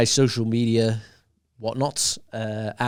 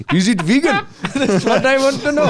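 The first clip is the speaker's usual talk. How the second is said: mock Indian accent, laughing; 5 dB louder than usual. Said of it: "doing an Indian accent".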